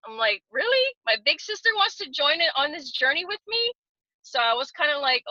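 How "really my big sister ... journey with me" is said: Really jumps way up, higher and excited; thrilled disbelief her big sister joins; delighted surprise. Retold or imitated. Retelling her sister's yes, thrilled and much higher.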